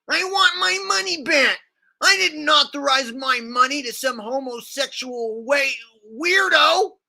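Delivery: high-pitched, shouty parody of a ranting rocker; hammed-up rally rant; mocking impression. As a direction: mimic his rant in an exaggerated high yell. Mimics the clip just played.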